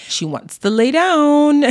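playful sleepy whine; mock sleepy. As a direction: whine like a tired kid ready for bed. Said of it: "My eyes are getting very heavy".

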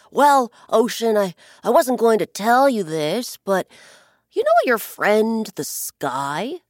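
a hint of a sly bird leaning in with a secret; sly blackbird. Blackbird baiting the ocean with gossip, loud and sly. Bird slyly stirring trouble.

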